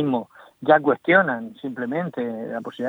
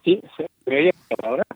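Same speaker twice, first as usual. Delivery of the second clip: radio breaking up; much faster than usual. Real, not acted. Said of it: "seguimos teniendo problemas en la recepción".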